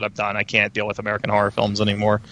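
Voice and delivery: normal talking voice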